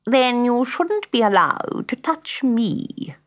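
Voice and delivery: high, slow haughty scolding; cross grand piano. A narrator's reading. Grand piano rebuffing Pat, cross and slow. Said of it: "said the grand piano crossly".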